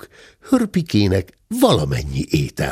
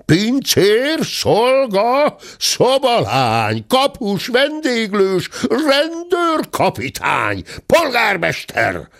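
shouting; slower than usual. A narrator's reading. The squire summoning the whole inn staff, shouting. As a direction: yell for everyone at once. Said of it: "egyre ordítozott".

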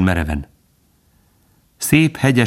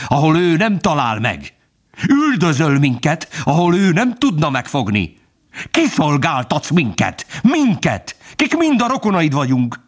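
hate-filled contempt; 10 semitones higher than usual. A narrator's reading. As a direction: build to a piercing, outraged reproach. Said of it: "megfeszült a gyűlölettől és a megvetéstől". (gyűlölettel és megvetéssel vádolva)

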